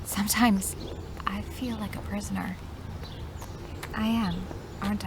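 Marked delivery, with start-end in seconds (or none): 0.0-2.6 soft, high near-whisper; confiding. Acted. Grounded girl confides in friend, soft and wistful. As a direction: confide it softly, almost whispered.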